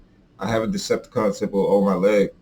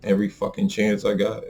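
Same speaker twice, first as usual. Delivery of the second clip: usual speaking voice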